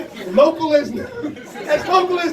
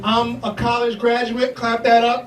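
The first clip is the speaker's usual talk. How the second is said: ordinary talk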